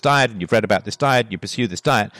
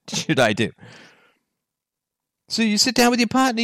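ordinary talk

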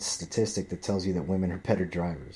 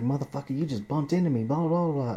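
imitating her yelling, pitched up; shrill-free mock yell at the driver, higher voice; mock screaming woman; 7 semitones higher than usual. Angry driver yelling at him, mimicked higher. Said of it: "She's getting out fucking screaming at me".

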